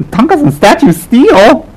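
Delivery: high-pitched, disbelieving echo; mock incredulity. Teacher answering an accusation of stealing statues, mock incredulous. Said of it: "I'm like".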